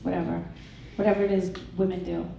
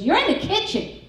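daughter's retort; 11 semitones higher, 4 dB louder than usual. Retold or imitated. Daughter voiced in retold story.

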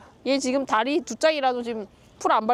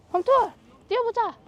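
calling a dog; 11 semitones higher than usual. Real, not acted.

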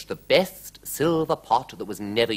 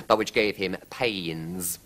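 ordinary delivery, nothing marked

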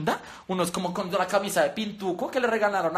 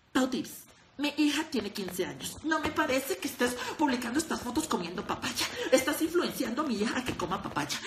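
softer, lightly voiced prim mother's scold; disapproving mother; 3 semitones higher, 5 dB quieter than usual. Imitating a mother commenting on Pau Tips' photo, mild prim disapproval. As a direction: read it like an offended mom, half laughing. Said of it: "y otro comentario".

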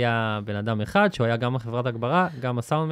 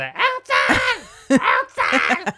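extremely high, drawn-out mock shriek; shrieking impression of a woman hollering a name; imitated viral-video woman; much slower than usual. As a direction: screech the name as if calling from behind. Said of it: "ואז היא מאחוריו כזה".

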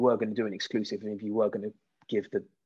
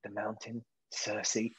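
a much softer, grim murmured list of names; Arya's list; 9 dB quieter than usual. Retold or imitated. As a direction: recite the kill list under your breath. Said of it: "she's going".

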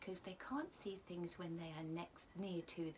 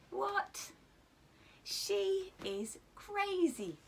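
astonished hippo; 9 semitones higher, 6 dB louder, much slower than usual. Acted. Hippo laughing at Giraffe.

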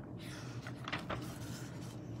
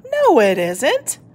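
a little cross; 3 semitones higher, slower than usual. Acted. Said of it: "feeling a little cross".

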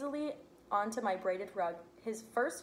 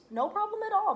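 bright and eager; 13 semitones higher, 7 dB louder than usual. A narrator's reading. Mom reassures Jack's mom.

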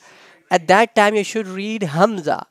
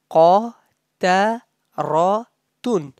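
Qataratun slowly in four separate pieces; syllable by syllable; slower than usual. Shown as a demonstration. Teacher breaking the word up for students, syllable by syllable. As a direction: pause between qa, ta, ra and tun. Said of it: "When you say together".

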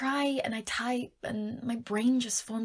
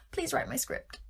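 small, higher begging voice; pleading; 4 semitones higher, 4 dB quieter than usual. Pretend. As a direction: ask sweetly, almost helpless. Pleading after Mufasa appeal.

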